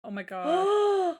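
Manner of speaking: high, slow, wailing oh my God; anguished wail. Real, not acted. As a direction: cry it out in dismay, drawn out. Outraged at heavy lifting.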